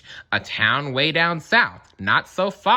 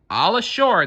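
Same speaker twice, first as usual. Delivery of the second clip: high call of the crew; shouted; 8 semitones higher, 4 dB louder than usual. A narrator's reading. Sailors calling the landing, a light high shout. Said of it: "the men shouted".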